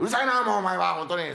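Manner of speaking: loud, irritated snap back at his partner; annoyed shout. Acted. Fed-up comic tells his partner off loudly. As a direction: cut him off, fed up and loud.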